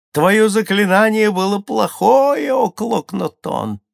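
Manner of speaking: high, loud mocking call; jeering crowd. A narrator's reading. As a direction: jeer loudly, drawing out the name at the end. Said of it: "смеялся народ". (громкая насмешка толпы)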